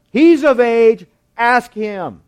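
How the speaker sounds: loud, high, insistent buck-passing; hammed-up parents refusing to take part; emphatic deflection. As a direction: push the blame away loudly. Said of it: "They were not taking part in this".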